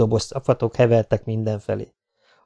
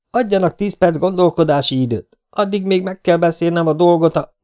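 raised-pitch, projected request; calling back; 8 semitones higher, 4 dB louder than usual. Acted. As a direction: shout your terms out to the captain. Besieged man calls back to captain. (kikiabált alkudozás)

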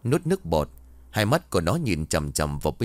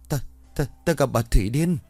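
breathless and stammering; 5 semitones higher than usual. A narrator's reading. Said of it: "Đoạn nó vừa thở vừa bảo".

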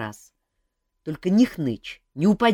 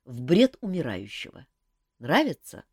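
plain reading voice

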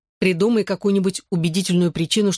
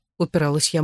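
usual speaking voice

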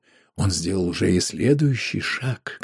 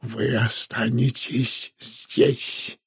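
very slow, strained hoarse whisper; hoarse, distorted; 3 dB quieter, much slower than usual. A narrator's reading. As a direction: force it out slowly and hoarsely. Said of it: "хриплый, искажённый голос трактирщика". (медленно, сдавленным хриплым шёпотом)

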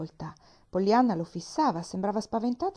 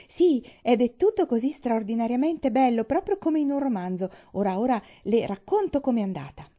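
higher and louder, bursting with glee; joyful excitement; 6 semitones higher, 6 dB louder than usual. A narrator's reading. Girl bursting to tell the doctor her secret, gleefully higher and louder. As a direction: bounce on the seat with joy as you tell it. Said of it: "fece un piccolo balzo di gioia".

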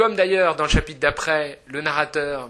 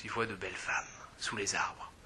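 breathy hushed whisper, much softer; 6 semitones lower, 14 dB quieter than usual. Real, not acted. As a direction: whisper it like sharing a secret. Describing voyeur scene, hushed.

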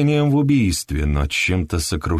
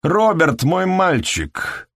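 bravado; 9 semitones higher, slower than usual. A narrator's reading. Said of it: "с бравадой".

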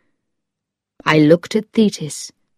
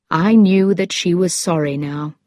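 ordinary delivery

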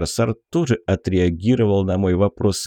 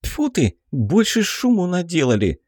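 scornful snort; 9 semitones higher than usual. Acted. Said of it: "фыркнула Белка".

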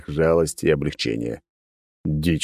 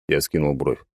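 ordinary delivery, nothing marked

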